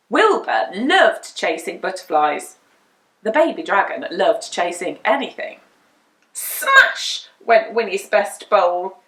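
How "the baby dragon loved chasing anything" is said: ordinary delivery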